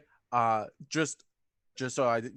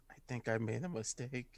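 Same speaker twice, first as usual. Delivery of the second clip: hushed aside, much softer; confiding aside; 2 semitones lower, 10 dB quieter than usual. Acted. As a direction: lean in and confide it quietly. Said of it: "she leans over the brood and goes".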